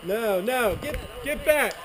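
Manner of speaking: urgent call. Real, not acted. Urging teammate back.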